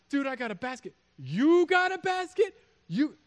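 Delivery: high-pitched incredulous disciples comparing finds; loud, high comic disbelief; amazed disciples. Acted. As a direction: squeal it in astonishment, leaping way up in pitch. Disciples amazed at their baskets.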